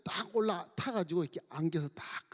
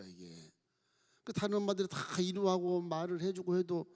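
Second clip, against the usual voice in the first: ordinary delivery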